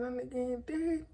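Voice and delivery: squeaky high, playing dumb; mock innocent surprise. Me playing innocent to my daddy, high mock surprise. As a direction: pitch way up like you have no idea what he means. Shocked the man survived.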